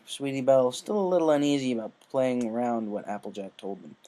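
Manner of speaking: usual speaking voice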